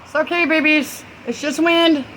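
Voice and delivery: high-pitched comforting lilt; soothing pet talk. Real, not acted. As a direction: soothe babies scared of the wind. Soothing her pets.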